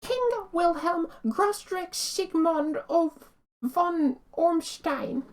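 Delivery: slow, drawn-out German-style name; stately German-accented name, slowly; German pronunciation. Acted. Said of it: "Can't say Stein without being in German".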